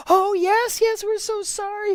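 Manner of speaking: high, pleading apology; the scolded couple apologises to Swami, high and pleading; contrite apology. Retold or imitated. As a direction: beg forgiveness in a high voice.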